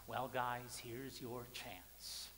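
normal talking voice

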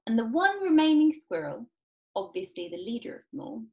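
usual speaking voice

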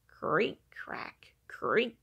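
ordinary delivery, nothing marked

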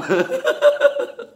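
very high shrieking laugh; squealing laugh. Real, not acted. Laughing at the friend eating.

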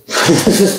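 short, high laugh; loud little chuckle; real laugh. Real, not acted. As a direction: laugh it off briefly. Laughs while defending taking tools.

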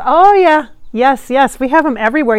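a loud, bright, eager yes. Retold or imitated. Medium passing on George's answer about evil eyes, loud and eager. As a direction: burst out agreeing with real enthusiasm.

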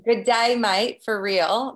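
hammed-up Australian greeting, drawn out; terrible Australian accent. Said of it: "That was terrible accent".

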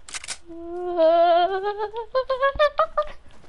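long wavering cry climbing in pitch; celebratory yell. Real, not acted. Gamer celebrating first solo win, rising yell. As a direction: let out a drawn-out rising victory whoop. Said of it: "What the hell are you yelling for".

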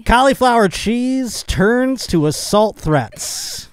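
slow, high, drawn-out headline announcement; headline read-out. Acted. Host reading a bizarre news headline to the room, grandly announced.

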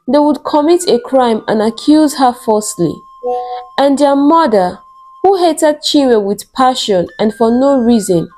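ordinary delivery, nothing marked

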